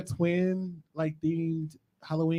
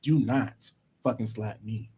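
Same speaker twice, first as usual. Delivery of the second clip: ordinary delivery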